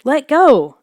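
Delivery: high, loud shriek; panicked shriek to be let go. A narrator's reading. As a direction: scream it out in panic. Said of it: "I shrieked".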